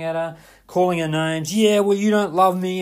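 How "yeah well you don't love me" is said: higher, louder sulky accusation; guilt-trip her like a hurt child; petulant brother. Retold or imitated. Brother guilt-tripping his sister.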